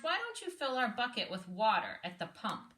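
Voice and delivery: normal talking voice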